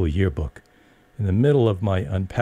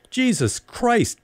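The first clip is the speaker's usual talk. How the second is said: drawn-out high exasperated outburst; flaring up; much slower than usual. Retold or imitated. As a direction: blow up at the mother, slowly. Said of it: "Brenda flared".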